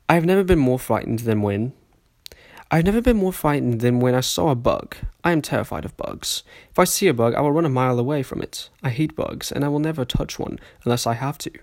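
put-on amateur posh British reading accent; shaky British accent that drifts toward Australian; sophisticated British accent. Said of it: "reading this in a sophisticated British accent".

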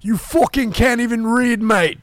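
put-on high, loud Conor McGregor Irish accent; loud mocking Irish accent. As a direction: trash talk in a thick Irish accent. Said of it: "you gotta do his, uh, accent".

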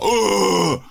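high, drawn-out stage whoop; demonstrated crowd-rousing holler. Shown as a demonstration. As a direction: call out to get a crowd response. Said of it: "Bara sett respons, men bara".

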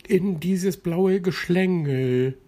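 a tiny drop picks its landing spot; high little drop voice. A narrator's reading. Plitsch decides where to land, high and slow. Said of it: "entschied er sich".

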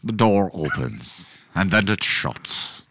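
slow, low, hushed narration; deliberate low narrator voice; stage-direction narrator. Acted. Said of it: "kinda like a narrator".

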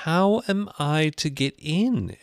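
slow, loud, high demand. A narrator's reading. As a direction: spell it out loudly and slowly as Alice. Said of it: "asked again in a louder tone".

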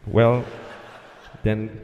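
slow, low, halting go-ahead with long gaps; hesitant controller. Acted. Tower clearing the desperate pilot to land, hesitant. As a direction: give clearance reluctantly, drawn out and unsure. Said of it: "That's how he said it".

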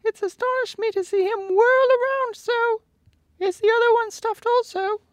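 a big coward amazed; high squeaky lion wondering; astonished lion. A narrator's reading. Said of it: "remarked the lion".

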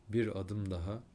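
ordinary talk, nothing marked

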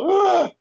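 high loud mock yelp of pain; cartoon cry of a bitten snake; snake's pained yelp. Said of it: "Snake was like".

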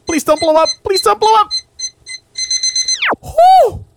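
high, breathless panic; frantically pleading it won't explode; panicked. Acted. Acted skit, panicked alone.

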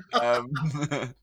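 usual speaking voice

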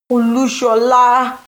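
loud, high and drawn-out joyful cry; slow jubilant yell; elated yell. A narrator's reading. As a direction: shout the name in pure relief. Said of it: "I yelled with elation".

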